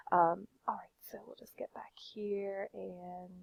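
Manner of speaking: soft unvoiced whisper, half to himself. Real, not acted. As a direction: drop to a whisper. Muttered aside before resuming.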